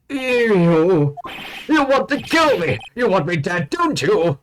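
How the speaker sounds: panicked accusing shout. Acted. Voiced accusation, shrill alarm.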